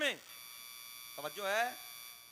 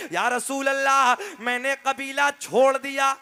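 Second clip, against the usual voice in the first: fervent, drawn-out preaching delivery; 6 semitones higher, 19 dB louder, much slower than usual. Acted. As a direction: preach the scene to the crowd with feeling. Preacher narrating a naat story. (जोशीला वाअज़ का अंदाज़)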